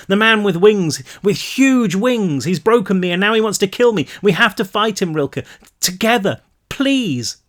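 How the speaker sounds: frantic pleading. A narrator's reading. Brick pleading for help.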